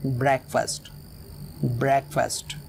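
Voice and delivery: Breakfast repeated with break stressed; weight on break, falling away on fast in breakfast; stress on first syllable. Shown as a demonstration. Teacher modelling how to say breakfast, stressed at the start. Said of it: "बोलते समय इस word को starting में stress होगा".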